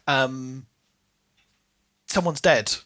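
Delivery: normal talking voice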